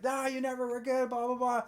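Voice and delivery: high, hammed-up rant; mock emotional outburst. Retelling the husband's outburst at his wife, mock ranting. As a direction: imitate him letting it all out, high-pitched. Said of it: "letting all his emotions out".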